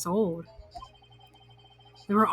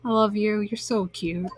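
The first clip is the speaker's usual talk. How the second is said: ordinary talk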